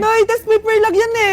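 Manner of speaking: loud, high, pleading yell; panicked protest. Acted. Child protesting to enraged mother, panicked. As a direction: shriek an excuse while being chased.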